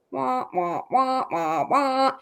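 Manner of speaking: muffled wah wah talk; droning trombone-like wah wah; Charlie Brown teacher voice. Retold or imitated. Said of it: "that Charlie Brown teacher voice".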